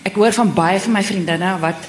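ordinary delivery

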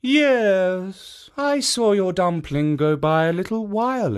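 a lightly voiced kindly guardian; kindly statue voice. Jitso statue speaks.

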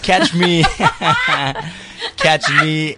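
high, slow plug broken by laughter; laughing. Shown as a demonstration. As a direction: laugh through the promo line. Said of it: "give us one that we can use".

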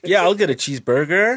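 put-on American accent. Imitating an American ordering a cheeseburger, American accent. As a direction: order it like a loud tourist. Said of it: "say cheeseburger like that".

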